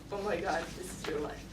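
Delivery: low, hushed inner voice; sobering realization. Retold or imitated. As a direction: let it sink in, dropping very soft. Sobering realization quoted to self.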